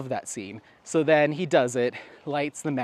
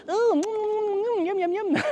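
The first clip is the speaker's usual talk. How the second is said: squirrel lady voice. Retold or imitated. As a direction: squeal it out like a batty lady tasting something. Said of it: "she's like".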